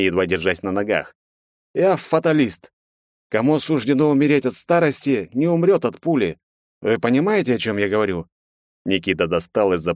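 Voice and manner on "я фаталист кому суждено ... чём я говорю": a hint of drunken solemnity; serious, tipsy fatalist. A narrator's reading. Drunk Nikita solemnly explains.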